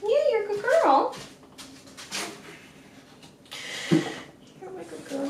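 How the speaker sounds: high, soft praise for the dog; pet talk. Real, not acted. Owner softly praising her dog.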